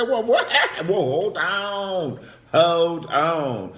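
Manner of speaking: high, loud comic alarm; stretched-out, high-pitched objection; mock shocked protest. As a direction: halt everything in hammed-up disbelief. Acting out shocked interruption of story.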